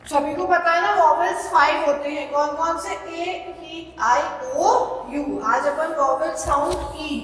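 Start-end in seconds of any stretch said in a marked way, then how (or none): none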